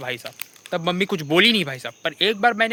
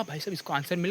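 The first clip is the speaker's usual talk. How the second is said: quiet aside; 3 semitones lower, 13 dB quieter than usual. Retold or imitated. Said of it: "मैंने कहा".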